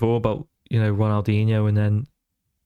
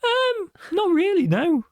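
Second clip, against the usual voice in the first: much higher, slower, teasing voice; lightly squeaky joking answer; mock high voice. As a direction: say it in a coy squeaky tone. Teasing about parents' size.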